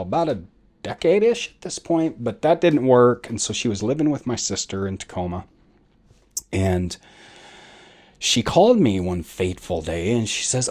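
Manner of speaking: ordinary talk, nothing marked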